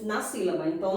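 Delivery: ordinary talk, nothing marked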